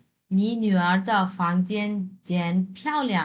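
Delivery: very slow, syllable by syllable; slow classroom Chinese; slow model reading. Shown as a demonstration. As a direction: read the sentence slowly for learners. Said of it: "question আসছে".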